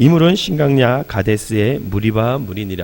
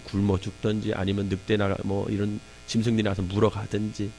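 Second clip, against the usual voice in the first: usual speaking voice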